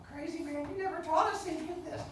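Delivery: high-pitched mock pupil complaint; imitating protesting pupils. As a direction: protest like an indignant kid. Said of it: "I was like".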